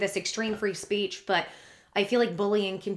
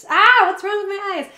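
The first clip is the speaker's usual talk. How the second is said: loud, high cry of frustration at welling tears; exasperated, fighting back real tears; tearing up; 11 semitones higher, 11 dB louder than usual. Real, not acted. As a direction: burst out, much higher and louder. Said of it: "I'm almost gonna cry".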